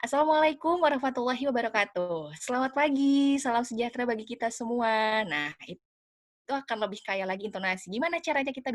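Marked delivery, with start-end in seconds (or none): 0.0-5.2 higher, louder, melodic presenter greeting; projected MC-style welcome with swooping pitch; rich intonation. Shown as a demonstration. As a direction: open the event with a lively, varied tune. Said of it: "lebih menarik lagi dengan intonasi yang kaya".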